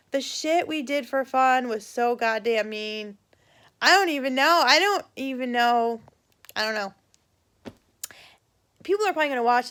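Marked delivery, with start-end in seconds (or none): none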